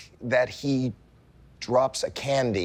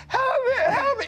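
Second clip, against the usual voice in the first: very high, louder panicked cry for help; retold screaming; 4 dB louder than usual. Mother scrubbing his hand with alcohol, son squealing high for help. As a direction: squeal it like your hand is burning. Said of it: "and I'm screaming, I'm going".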